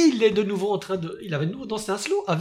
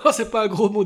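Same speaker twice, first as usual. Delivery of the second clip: usual speaking voice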